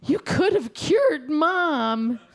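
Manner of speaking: high, drawn-out punchline yell; outraged shout. Acted. Brother accusing Bob after punching him, outraged shout. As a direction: stretch out each word in acted outrage.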